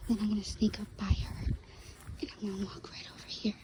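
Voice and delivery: sneaky whisper, fast at the end. Real, not acted. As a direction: hush it like you're hiding. Sneaking up on the dog.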